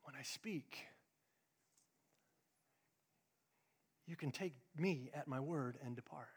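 unvoiced hushed whisper; soft whispered word from God. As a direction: breathe it like a still small voice. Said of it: "still small voice of the Lord".

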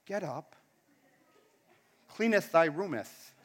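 low, soft, solemn mock scripture; mock-biblical parent. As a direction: intone it softly like holy writ. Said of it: "Or do you go".

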